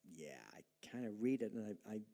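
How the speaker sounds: low, soft, noncommittal mumble; half-hearted reader. Acted. Preacher voicing what most of us are thinking, half-hearted and hesitant. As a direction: imitate a listener hesitantly trailing off. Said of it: "most of us are kinda going".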